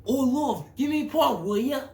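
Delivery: screeched, very loud British holler; hammed-up British come-on, shouted; British accent. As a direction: yell high like a caricature Brit. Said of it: "I like a British accent".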